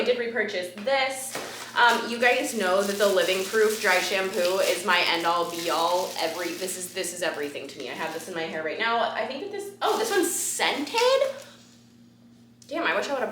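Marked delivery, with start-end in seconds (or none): none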